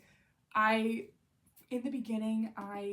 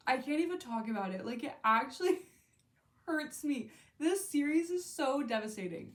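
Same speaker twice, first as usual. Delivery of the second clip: getting emotional; 5 semitones higher, 4 dB louder than usual. Real, not acted. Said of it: "I'm getting emotional".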